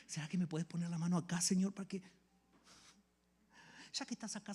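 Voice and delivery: ordinary talk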